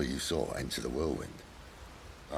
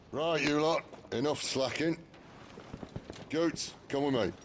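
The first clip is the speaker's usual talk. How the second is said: brisk leader's call, pitched up; rallying orders; 7 semitones higher than usual. Acted. Leader to the idle crew and Gav, rallying orders. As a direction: round up the troops with a raised voice.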